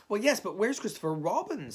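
high, loud, insistent question; impatient challenge. A narrator's reading. Rabbit demanding Christopher Robin's whereabouts from Owl, impatient. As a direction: cut in with the real question.

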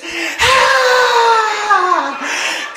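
long, loud, high strained wheeze; desperate gasping breath. Retold or imitated. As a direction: gasp hard as if badly winded. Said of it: "He was breathing like this".

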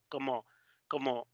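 usual speaking voice

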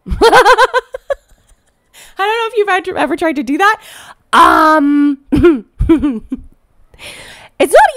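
real giggle breaking through the words; high-pitched laughing aside; laughing while talking. Real, not acted. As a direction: laugh your way through the question. Laughing at own child-instruction story.

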